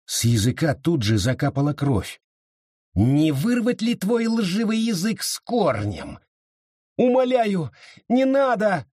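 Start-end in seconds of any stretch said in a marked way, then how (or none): 2.9-6.2 high-pitched, taunting threat; menacing question, voice lifted high; witch's menacing threat. A narrator's reading. Witch threatens the accused girl. 7.0-8.9 high, desperate begging; frantic mother's plea; pleading cry. A narrator's reading. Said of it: "крикнула Энн".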